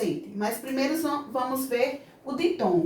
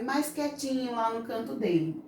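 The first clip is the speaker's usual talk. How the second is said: softly; 5 dB quieter than usual. Shown as a demonstration. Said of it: "e o I fica mais suave".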